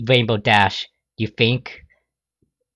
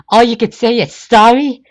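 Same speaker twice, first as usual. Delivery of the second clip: high loud indignant; outraged; 12 semitones higher, 8 dB louder than usual. Acted. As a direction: scorn his apology. Rainbow outraged.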